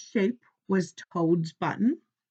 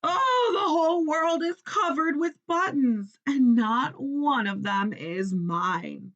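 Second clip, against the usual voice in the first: angry scream; 6 semitones higher than usual. A narrator's reading. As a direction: rage over the buttons, near tears. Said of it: "started screaming".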